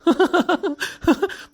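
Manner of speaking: high teasing burst of laughter; a high amused laugh. Acted. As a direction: laugh at the friends' mix-up. Laughing at his friends.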